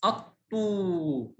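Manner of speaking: الطو with the final "oo" held long; slow, drawn-out الطو with long waw; long vowel. Shown as a demonstration. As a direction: stretch the ending of الطو, "at-Tooo". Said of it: "مع مد".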